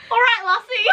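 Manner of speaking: playful fake Irish accent; a laughing stab at an Irish brogue; mock Irish accent. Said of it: "do an accent until the next round".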